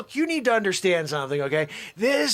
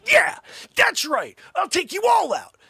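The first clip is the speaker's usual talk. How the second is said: hammed-up sensei screaming from the sideline; mock sideline yell; 11 semitones higher, 5 dB louder than usual. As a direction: cheer like a fired-up old coach. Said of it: "Miyagi-san's on the sideline going".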